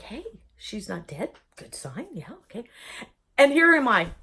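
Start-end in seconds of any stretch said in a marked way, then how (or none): 0.0-2.6 much softer, muttered relief; hushed family. Retold or imitated. Family whispering at her bedside, hushed relief. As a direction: murmur it like relatives at a bedside. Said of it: "It's like".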